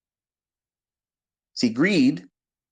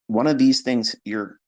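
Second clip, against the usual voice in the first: normal talking voice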